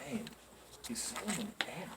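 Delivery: very soft deadpan delivery; understated, deadpan punchline dropped quietly after a pause; straight-faced. Retold or imitated. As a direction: imitate a manager saying it with no reaction. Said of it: "straight-faced as hell, like he is".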